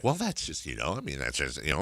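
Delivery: soft, mumbled backpedal; retold hedging. Host imitating the doctor making excuses, hedging quietly. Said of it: "And he's like".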